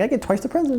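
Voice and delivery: put-on high childish voice; lightly voiced child; mock kid. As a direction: tease like a greedy kid. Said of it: "You're like".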